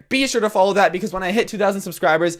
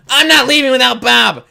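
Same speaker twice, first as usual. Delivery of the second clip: loud, higher mock yell; imitated yelling; 6 semitones higher, 7 dB louder than usual. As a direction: imitate a stubborn man yelling his refusal. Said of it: "he's just standing there yelling at me".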